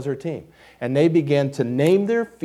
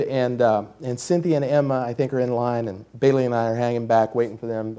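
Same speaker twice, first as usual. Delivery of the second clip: usual speaking voice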